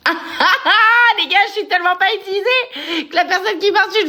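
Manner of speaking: high laughing through the words. Real, not acted. Laughing at her own blunder to the viewers, giggling. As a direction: laugh at your own mistake as you talk.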